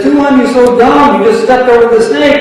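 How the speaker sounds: high, scolding native voice jeering at him; mocking taunt. Villagers jeer at the missionary for stepping over snake, high mocking taunt. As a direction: tease him like a fool who missed the snake.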